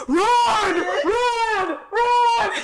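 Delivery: loud high yelled run; shouting run. Acted. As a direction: yell it in mock panic. Shouting what she'd do.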